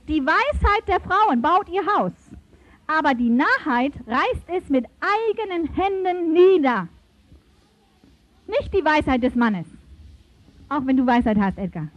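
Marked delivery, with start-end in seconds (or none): 0.1-6.9 scripture reading. Acted. Reads Bible verse aloud.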